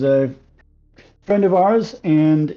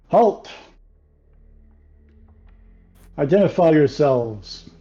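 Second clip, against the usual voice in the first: firm, raised-voice challenge; calling out; 3 semitones higher, 3 dB louder, slower than usual. Acted. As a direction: order strangers to stop and identify themselves. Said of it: "call out and, uh, say".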